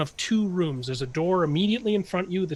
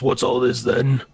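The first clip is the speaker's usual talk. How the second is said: gruff dwarf; 7 dB louder than usual. Acted. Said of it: "he goes".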